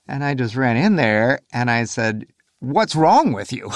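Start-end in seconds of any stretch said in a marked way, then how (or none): 2.6-3.7 slow, high, pained what's wrong with you; drawing out wrong in a raised voice; retold angry yell. Father bursting in on crying wife and daughter, slow raised yell. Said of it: "I sort of yelled at her".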